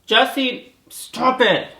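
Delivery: high, loud scold; real exasperated yell at the pet; scolding shout. Real, not acted. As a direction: yell the cat's name to stop it. Scolding cat mid-video.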